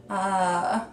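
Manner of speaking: usual speaking voice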